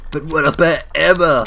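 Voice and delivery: loud, high-pitched outburst; mock outcry. Interrupting the sympathetic chat, mock outcry.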